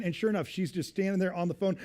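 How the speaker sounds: ordinary talk, nothing marked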